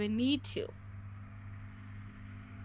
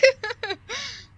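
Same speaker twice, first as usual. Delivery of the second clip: high laugh; 12 semitones higher, 4 dB louder than usual. Real, not acted. Laughing after feeling empathetic.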